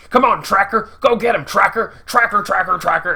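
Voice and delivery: mocking dog handler's calls; Jeffrey Dean Morgan. Villain urging his tracking dog on, mocking imitation. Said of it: "annoying to keep hearing Jeffrey Dean Morgan say".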